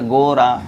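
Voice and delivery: गोरा with a long first o; slightly stretched. Shown as a demonstration. Teacher showing how to read the word, slightly stretched. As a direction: draw out the o in गोरा. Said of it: "इसको थोड़ा खींच के पढ़ेंगे".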